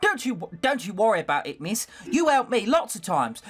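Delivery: put-on high-pitched working-class London maid accent; Becky's London accent. Maid helping a young lady dress, high London accent. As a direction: play the servant girl in a London accent. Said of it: "I have to fucking do this accent".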